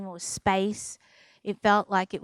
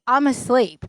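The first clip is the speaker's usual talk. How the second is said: rapid string of nagging questions, building louder; pestering checklist, getting more insistent; annoying interrogation; 3 semitones higher, 6 dB louder than usual. Retold or imitated. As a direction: imitate a patient grilling the surgeon. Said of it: "I'm the most annoying".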